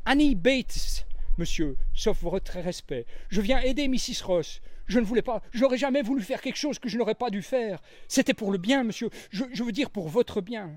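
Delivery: high, timid little maid's voice; young maid. A narrator's reading. Annie the young maid explaining herself to Poirot, high and timid. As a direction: speak up shyly but earnestly.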